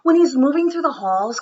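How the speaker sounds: put-on gentle read-aloud storytelling for children; story reading. Teacher reading a story to her students, storybook voice. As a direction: read it to the class like a picture book.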